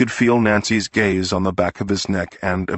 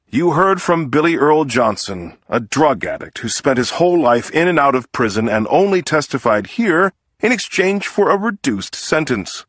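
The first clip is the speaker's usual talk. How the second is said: courtroom appeal to the jury, lightly voiced; a hint of a persuasive lawyer, a bit faster and brighter in pitch; defense lawyer's plea; 7 semitones higher, 3 dB louder than usual.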